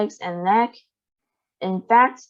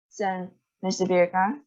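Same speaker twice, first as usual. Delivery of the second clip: usual speaking voice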